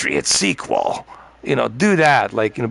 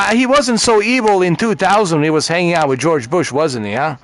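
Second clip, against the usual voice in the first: ordinary talk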